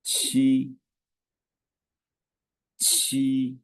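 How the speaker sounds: Qi high and level, breathy q; first tone demo. Shown as a demonstration. Teacher modelling seven in Chinese, high level tone. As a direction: keep Qi high and steady. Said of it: "Say seven in Chinese".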